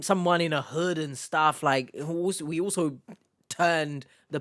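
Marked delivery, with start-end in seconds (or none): none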